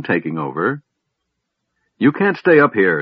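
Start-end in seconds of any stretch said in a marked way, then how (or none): 2.0-3.0 raised, higher urgent voice; shouted warning. A narrator's reading. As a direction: shout a warning over the deck. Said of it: "Sturm shouted".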